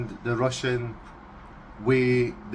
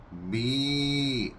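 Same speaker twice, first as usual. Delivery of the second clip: Russian sheep bleat. Shown as a demonstration.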